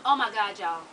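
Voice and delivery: usual speaking voice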